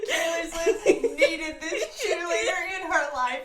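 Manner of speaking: high, loud, helpless laughter through the words; laughing. Real, not acted. Host reacting to Taylor's cheerleader, laughing. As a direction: squeal it out while cracking up. Host cracking up over the clip.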